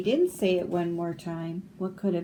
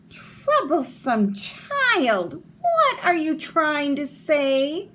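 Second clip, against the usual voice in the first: slow, higher, lightly scolding; a hint of a vexed mother; impatient mother; 7 semitones higher, 4 dB louder, slower than usual. Mother scolds her son she cannot hear, slow. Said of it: "said his mother".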